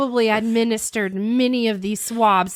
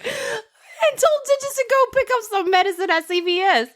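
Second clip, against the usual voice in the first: higher, laughing through the words; stunned disbelief dissolving into laughter; bewildered, laughing; 10 semitones higher than usual. Retold or imitated. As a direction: friend voicing the stunned patient, laughing. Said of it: "And it's like".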